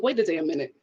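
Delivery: low, muttered disbelief; suspicious double-take. Retold or imitated. Speaker recalls seeing Ivan's parents, suspicious double-take. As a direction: pull up short in low disbelief.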